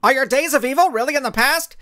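high, loud reporter question; reporter calling out. Acted. Reporter shouting a question at the Blinding Skull. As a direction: call out over the crowd.

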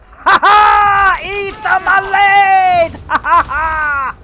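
loud, high gloating shouts; worked-up gamer yelling in triumph; gleeful battle shouting. Real, not acted. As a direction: roar taunts at a fleeing enemy. Battle shouts at a game enemy.

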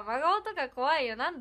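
ordinary talk

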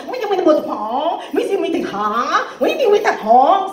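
put-on husband's swooping Vietnamese-accented retort; Vietnamese accent, arguing. Husband arguing back at his wife, Vietnamese accent. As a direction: snap back in mock Vietnamese with steep rising ends. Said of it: "the husband was like".

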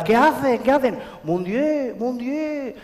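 a touch of a panicky French officer; frightened French general. Acted. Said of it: "Decía el general".

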